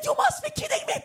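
high, amazed squeal of disbelief. Retold or imitated. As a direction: gasp it out as if you can't believe it. Stunned by free meal news.

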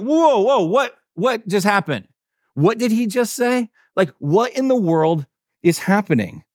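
high-pitched, acted-out bewilderment; startled disbelief. Disciples hearing the liturgy suddenly changed, acted shock. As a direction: pull everything up short, voicing stunned listeners. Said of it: "like a scratch on the record".

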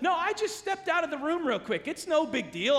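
high-pitched mock breeziness; imitating an overeager talker; eager reassurance. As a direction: brush it off, too keen to please. Imitates man brushing off daughter's recital.

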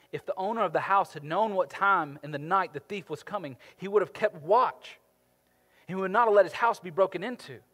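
loud, urgent sermon delivery, slowing on key words; impassioned preaching. Acted. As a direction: preach it with fire to the congregation. Scripture preached at full force.

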